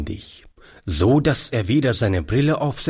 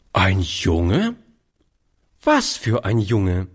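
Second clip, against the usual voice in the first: ungläubige Nachfrage; 3 semitones lower than usual. Acted. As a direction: echo it as an incredulous question. Aunt echoes it in disbelief. (ungläubig nachgefragt)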